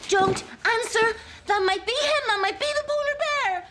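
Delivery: high, frantic warning not to answer; frantic high alarm about the knock; panicked. Acted.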